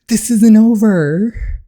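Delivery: slow, loud menacing threat; enemy vowing revenge, slow and loud. Acted. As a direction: spit out the threat slowly. Villain threat.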